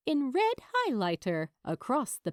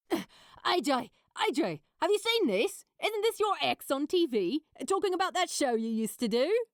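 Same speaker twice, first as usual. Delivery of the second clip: lightly excited co-star; 5 semitones higher, faster than usual. A narrator's reading.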